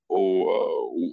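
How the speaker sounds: normal talking voice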